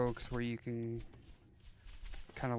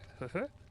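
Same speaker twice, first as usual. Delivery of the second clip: giggle; 7 semitones higher than usual. Real, not acted. Laughs at the Goofy idea.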